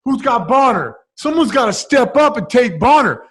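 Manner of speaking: high, loud mock goading of each teammate; imitating a teammate goading. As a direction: call out each teammate in turn, needling. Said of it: "It's like".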